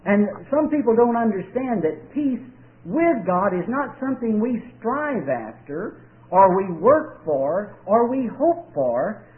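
usual speaking voice

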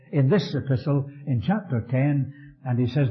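ordinary talk

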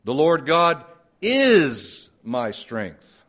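is stressed, very slow; slow reading with is emphasized. Shown as a demonstration. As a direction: dwell on is, unhurried. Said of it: "shift the emphasis in different places".

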